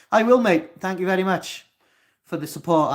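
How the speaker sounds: excited thanks. Real, not acted. Thanking a supportive viewer.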